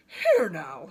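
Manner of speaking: low, loud indignant snort; angry snort. A narrator's reading. Tangled giraffe snorting at the vine, low angry. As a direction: bark it at the vine. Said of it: "snorted the giraffe".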